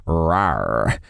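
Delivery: low, playful rawr growl. A narrator's reading. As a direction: growl it like a tiger. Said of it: "stop with the growling".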